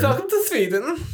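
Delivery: put-on high sing-song Swedish accent; lilting Swedish welcome. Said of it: "You need your best Swedish accent".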